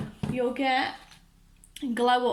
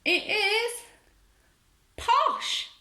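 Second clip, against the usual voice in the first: high squealing reveal, far above her usual voice; excited squeal; 10 semitones higher, 3 dB louder, much slower than usual. Real, not acted. Vlogger thrilled to reveal the doll she wanted, squealing high. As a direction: squeal the doll's name with delight.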